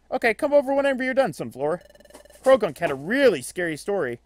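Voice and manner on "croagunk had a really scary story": normal talking voice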